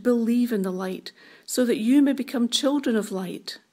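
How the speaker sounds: ordinary talk, nothing marked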